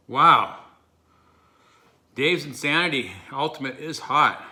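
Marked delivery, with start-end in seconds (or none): 0.1-0.6 sudden loud wow from the heat; loud pained wow. Real, not acted. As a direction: blurt it out, mouth on fire. Reacting to burning hot sauce.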